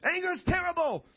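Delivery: high-pitched mock condemnation of sinners; mock scolding. Preacher parodies what Scripture doesn't say, high mock scolding. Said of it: "It doesn't say".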